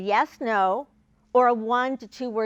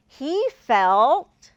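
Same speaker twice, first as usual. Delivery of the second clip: "He felt" said louder, with a rising tune left hanging open; starter cue; 5 dB louder than usual. Shown as a demonstration. Teacher to a child, "He felt" rising and left open. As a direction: lift "He felt" and leave it unfinished for the child. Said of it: "let the child finish that sentence".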